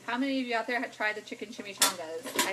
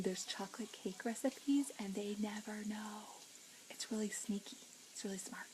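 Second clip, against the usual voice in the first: conspiratorial whisper; 10 dB quieter than usual. Acted. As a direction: let them in on the secret. Sharing a secret cooking trick.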